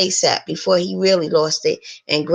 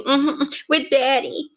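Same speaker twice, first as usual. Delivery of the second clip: higher-pitched, moaned reply; moaning, higher voice; narrated moan; 7 semitones higher than usual. A narrator's reading.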